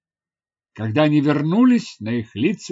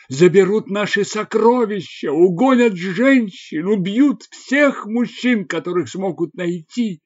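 higher, louder, mildly urgent warrior voice; alarmed warrior; 5 semitones higher, 5 dB louder than usual. Acted. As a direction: press the grim warning on the listener. Villager warrior explains the threat. (встревоженный воин)

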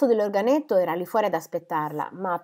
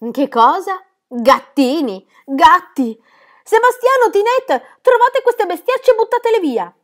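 high, very loud panicked shrieking; horrified outburst; 12 semitones higher, 10 dB louder, slower than usual. A narrator's reading. Governess learning of kittens and calling servants, horrified shrieking. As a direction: build from aghast disbelief to shrill orders.

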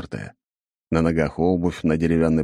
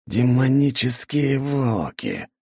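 hissed exclamation; 7 semitones higher, slower than usual. A narrator's reading. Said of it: "прошипел мужчина".

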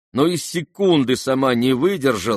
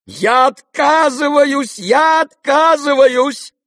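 very high, loud, drawn-out cry; vehement refusal; 9 dB louder, slower than usual. A narrator's reading. Wife refuses her husband's plea, vehemently. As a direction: wail it out, refusing to be involved. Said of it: "замахала руками Лизавета Прокофьевна".

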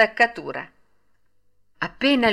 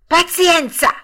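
high, loud sudden shout; sudden cry; 11 semitones higher, 7 dB louder, slower than usual. Acted. Father jumping up with a sudden resolve, shouting. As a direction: burst out, deciding all at once. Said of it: "gridò Geppetto tutto a un tratto".

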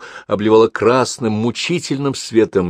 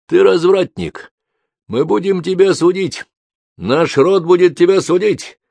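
outraged clan elder shouting at the seducer; angry accusation; 10 semitones higher, 4 dB louder than usual. Acted. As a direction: hurl the accusation at him. Family accuses Alexei.

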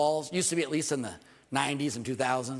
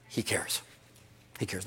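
whispered, gentle "He cares"; soft reassurance; 6 semitones lower, 6 dB quieter than usual. Real, not acted. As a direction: breathe it softly to comfort them. Closing tender reassurance, softly.

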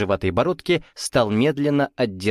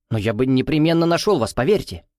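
ordinary delivery

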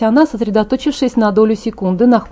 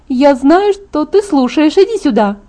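calling out; 6 semitones higher than usual. Acted. Calling her fiancé from garage.

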